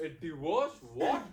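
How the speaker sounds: loud, rising shock; can't believe the word; shocked disbelief. Acted. Husband hearing his wife wants a divorce, stunned.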